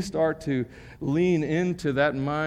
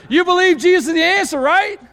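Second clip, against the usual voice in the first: higher and louder, pressing for an answer; louder second try; 11 dB louder than usual. Shown as a demonstration. Pastor retries the question to get a reply, louder. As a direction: push the question harder so they answer back. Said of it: "Let's try it again".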